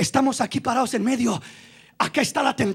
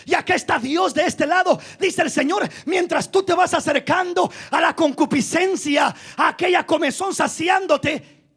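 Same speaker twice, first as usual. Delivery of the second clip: ordinary delivery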